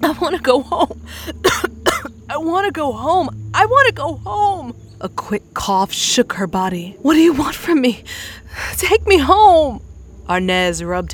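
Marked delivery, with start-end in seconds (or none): 0.0-4.7 high, tearful pleading, coughing; sputtering in tears. Acted. Lost girl begging strangers to take her home, crying. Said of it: "Love sputtered". 7.0-9.8 high, loud, desperate plea; frightened pleading. Acted. Scared girl confronting strangers, shrill frightened plea.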